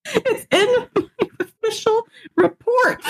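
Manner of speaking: very high, slow words squeezed through laughter; helpless laughter. Real, not acted. Patient telling a friend it's in the report, laughing helplessly. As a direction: barely get it out for laughing. Cracking up at her own report.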